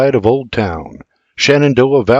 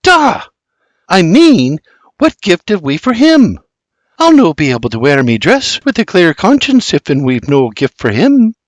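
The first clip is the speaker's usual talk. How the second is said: put-on much higher flustered Scots girl; worried daughter, higher and louder; exasperated exclaim; 6 semitones higher, 4 dB louder than usual. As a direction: roll your eyes and fret. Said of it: "Flora exclaims".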